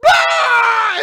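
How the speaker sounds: very loud, high comic scream; mock panicked scream. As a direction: scream like a cornered villain, for laughs. Imitates cornered villain screaming.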